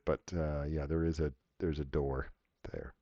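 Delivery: normal talking voice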